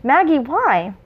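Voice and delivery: a touch of uncertain worry; wondering whether to be afraid; unsure, half frightened. A narrator's reading. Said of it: "a tone wondering whether it should be frightened".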